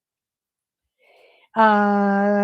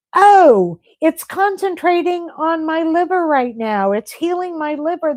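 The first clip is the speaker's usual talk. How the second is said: amazed discovery; 6 semitones higher, 5 dB louder than usual. Pretend. Imagines seeing inside her body.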